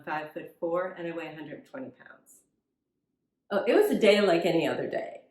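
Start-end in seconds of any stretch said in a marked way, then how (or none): 3.5-5.2 higher, louder opening line; lively, swooping delivery; animated storytelling. Acted. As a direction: launch into the tale with energy. Monologue's lively first telling.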